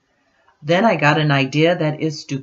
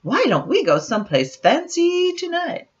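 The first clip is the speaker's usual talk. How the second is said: higher, light mock-elegant mom; mom's voice; 13 semitones higher than usual. Mom twirling at the mirror, higher mock-elegant voice. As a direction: sing it out like a glamorous mother. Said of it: "My mom twirls".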